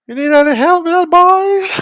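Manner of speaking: very high, loud mock teacher voice; hammed-up drunken falsetto. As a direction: squawk it like a tipsy teacher calling to schoolboys. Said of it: "one of my teachers came down the stairs, pissed".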